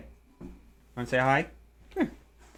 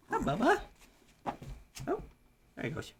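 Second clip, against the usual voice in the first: pet talk; 13 semitones higher, 10 dB quieter than usual. Real, not acted. Greeting his old dog.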